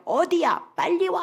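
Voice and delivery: high, pressing mock call; anxious checking. Wife checking she won't be left, anxious high-pitched urging. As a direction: imitate someone who keeps checking. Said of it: "계속 확인하는 거야".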